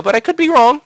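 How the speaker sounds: leaping way up high in pitch; mock modesty. Real, not acted. Gamer claiming credit for a rival's retirement, mock-modest. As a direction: undercut the boast in a high, coy voice.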